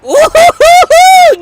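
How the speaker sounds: high, drawn-out hooting whoop; long high-pitched hollered woo-hoo; loud incredulous whoop. Real, not acted. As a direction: whoop loudly and high in disbelief. Reacting to AI forgetting everything.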